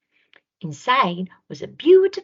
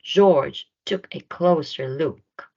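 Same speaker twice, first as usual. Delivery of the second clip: usual speaking voice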